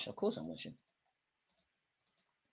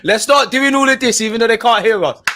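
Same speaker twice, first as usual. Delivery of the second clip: higher and louder, hyping up a crowd that can't hear; mock rallying shout; 9 semitones higher, 7 dB louder than usual. Voicing a captain rousing the home crowd, mock shout. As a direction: rouse the fans at home like a captain. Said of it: "Gabriel saying".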